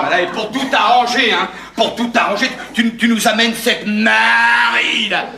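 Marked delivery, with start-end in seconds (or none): 2.7-5.2 slow, drawn-out scornful naming; disparaging tone. Acted. Brother complaining about the unwanted wife, scornful tone. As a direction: stretch the name out in contempt. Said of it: "parler de ma femme sur ce ton-là".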